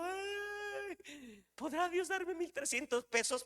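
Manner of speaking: very high, soft wail; small, high, worried voice, played for laughs; mock anguished doubt. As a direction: whimper it like a hammed-up doubter. Acts out his own wavering doubt.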